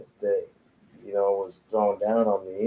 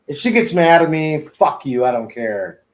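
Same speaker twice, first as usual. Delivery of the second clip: defiant joking outburst; 7 semitones higher, 7 dB louder than usual. Real, not acted. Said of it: "defiant aside".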